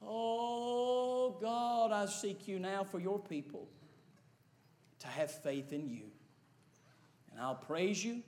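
hushed, pleading prayer; earnest prayer. Real, not acted. Leads closing prayer.

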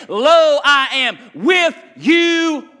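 loud, slow, high proclaiming of God's promise; preacher shouting God's words; booming proclamation. Acted. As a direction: thunder it out from the pulpit. Preacher quoting God at full force.

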